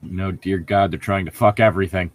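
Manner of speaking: ordinary talk, nothing marked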